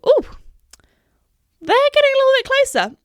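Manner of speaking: very high-pitched, hurried worry; playfully panicked high voice; mock alarm. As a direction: squeak it nervously, acting out rising panic. Acting out alarm as sheep follow.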